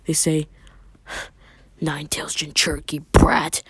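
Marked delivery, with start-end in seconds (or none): 1.8-3.6 hissed whisper of the crowd; scornful crowd whisper. A narrator's reading. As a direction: whisper it behind his back. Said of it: "Everyone begins whispering".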